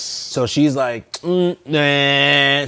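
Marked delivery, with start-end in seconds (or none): 1.2-2.7 a higher, louder, dismissive "nah"; dismissive refusal. Retold or imitated. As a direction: brush off the cops like they don't matter. Driver refusing to slow down.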